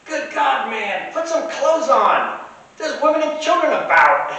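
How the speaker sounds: high, loud imitation of a scandalized vendor; yelling out. Vendor yelling at a half-dressed man, scandalized. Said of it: "one of the vendors yells out".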